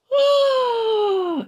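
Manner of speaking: a sudden piercing falsetto cry of shock; high shocked shriek. Retold or imitated. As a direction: shriek it out in surprise. (cri suraigu de surprise)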